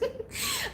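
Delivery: a quick high-pitched giggle; bright little laugh. Real, not acted. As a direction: giggle at the memory. Laughing before a funny story.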